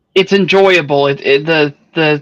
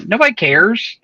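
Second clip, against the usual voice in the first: ordinary delivery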